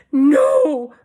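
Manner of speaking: shouted. A narrator's reading. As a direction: shriek the denial. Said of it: "Mom shouted".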